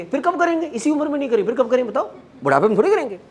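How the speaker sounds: high-pitched, pleading argument; insistent self-justifying. Acted. Mind arguing for enjoying life now, insistently self-justifying.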